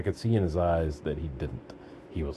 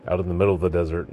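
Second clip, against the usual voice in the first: ordinary delivery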